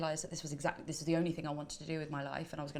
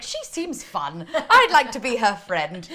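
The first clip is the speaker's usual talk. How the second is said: slow, loud, laughing would-be friend; hammed-up eagerness, louder; eager mock self-quote; 4 semitones higher, 13 dB louder, much slower than usual. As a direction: gush it slowly with a laugh. Acts out her eager past self.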